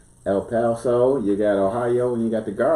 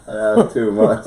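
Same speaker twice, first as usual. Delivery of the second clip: laughing; 4 semitones higher, 5 dB louder, much slower than usual. Real, not acted. Reacting to clip, laughing.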